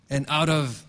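ordinary delivery

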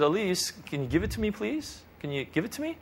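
sweetly asking, rising at the end; gentle coaxing. Retold or imitated. Father coaxing toddler to give it.

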